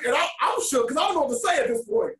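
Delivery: ordinary talk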